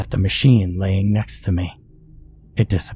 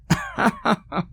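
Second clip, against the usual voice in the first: a high burst of laughter; high cackling laugh; 13 semitones higher, 4 dB quieter than usual. A narrator's reading. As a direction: cackle at the joke. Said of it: "busted out laughing".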